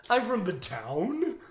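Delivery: high, slow voice of a waterlogged broken toy; broken-toy accent. Acted. Podcaster mocking his own failed accent, high broken-toy voice. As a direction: warble it like a toy dropped in water. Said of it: "I sound like a broken toy that you dropped in water".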